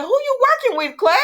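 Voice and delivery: high, loud squawked question; incredulous outburst. Acted. Vlogger challenging Claire on her scheme, incredulous outburst. Mock-confronting a soap character.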